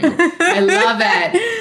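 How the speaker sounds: squealing high through a laugh. Real, not acted. Host giddy over the new reality show, laughing squeal.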